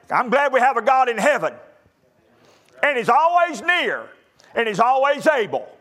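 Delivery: high, loud, fervent preaching; full-force preaching. Acted. Preacher to graduating youth, preaching at full force. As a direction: build to a ringing pulpit climax.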